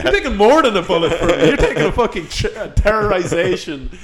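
loud mock Australian, half laughing; hammed-up Australian losing hold of the bit; frazzled Australian. Frazzled friend thanking Rory, mock Australian. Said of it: "the demon infested frazzled Australian".

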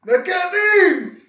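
high, loud, slow shouted hustler; pirate from Bahia. Retold or imitated. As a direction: call out like a street pirate. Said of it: "com o meu pirata da Bahia".

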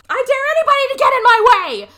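high, very loud screamed dare; unhinged yelling. Retold or imitated. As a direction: scream it like a crazed threat. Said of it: "And just yelling about it".

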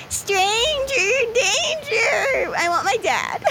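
high, slow, whimpering robot; whiny robot cry. Acted. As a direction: wail it like a lost child. Frank, higher and crying.

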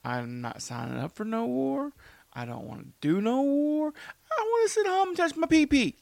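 mock a coward who won't go to war, rising to a high squeak; mock whiny draft dodger. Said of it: "Your dude at home, like".

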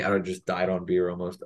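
usual speaking voice

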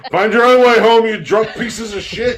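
high, loud send-off with a laugh creeping in; mock indignant shout. Designated driver abandoning drunk friends, mock shout. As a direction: yell it like a fed-up driver ditching friends.

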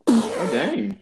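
high surprised exclamation; shocked by the pay. Real, not acted. Reacting to the pay.

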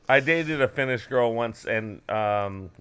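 ordinary delivery

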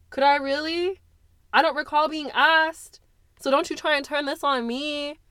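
high, loud, defensive retort; mother refusing blame; bitter anger. Acted. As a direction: throw it back at her. Mother's angry answer.

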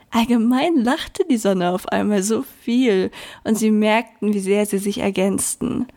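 ordinary delivery, nothing marked

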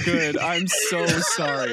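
real helpless laughter, high and loud; laughing. Real, not acted. Host apologizing to the crying friend, laughing hard. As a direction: apologize through a big, drawn-out laugh. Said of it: "laughing at me".

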